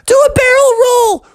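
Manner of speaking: very high, loud frog shout; excited call. Acted. Froggy urging Eddie on mid-flight, high excited shout. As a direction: squeal out a thrilled command.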